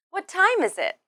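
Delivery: "What time" stressed high, then falling to the end; high start on "What time is it", falling finish; falling intonation. Shown as a demonstration. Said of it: "use a falling intonation at the end".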